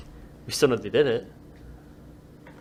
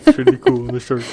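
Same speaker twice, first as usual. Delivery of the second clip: shark impression; 5 semitones lower than usual. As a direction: mumble like a goofy shark. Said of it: "It's my shark impression".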